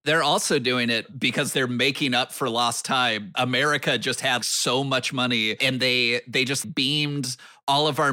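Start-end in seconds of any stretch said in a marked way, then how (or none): none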